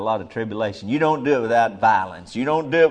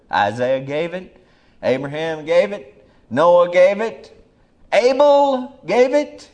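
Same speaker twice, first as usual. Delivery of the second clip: climbing higher and louder with each name; building preaching; 6 semitones higher, 8 dB louder than usual. Real, not acted. Preacher stirring the congregation, rising to a ringing shout.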